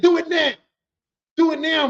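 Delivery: high, loud dare; acted challenge. Coworker dares the other to fight now, defiantly. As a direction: push it out as a mock challenge.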